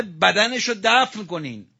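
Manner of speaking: a slow, high, loud wail; lamenting cry. Acted. Preacher crying the plea for burial, slow and loud. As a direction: stretch the plea out like a mourner's cry.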